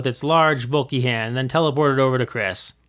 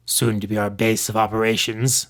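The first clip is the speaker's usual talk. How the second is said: a lower, cold villain voice; deep, quiet, chilling villain; 3 semitones lower than usual. Acted. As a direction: menace them softly, like a shadowy leader. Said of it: "in his deep, quiet, yet chilling voice".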